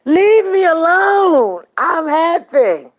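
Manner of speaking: high, slow, cry-laughing with delight; laughing squeal. Real, not acted. Wife laughing to her viewers about the date, squealing.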